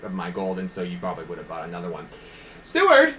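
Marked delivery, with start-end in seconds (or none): 2.6-3.1 loud royal summons; calling out loud. Acted. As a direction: call the steward across the hall. King calls out.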